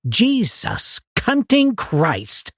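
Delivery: high, slow stunned oath; gaping, slow high exclamation; outraged disbelief. Acted. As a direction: drag it out in jealous shock. Gaping at the better room.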